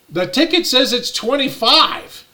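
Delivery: retold indignant objection; indignant protest.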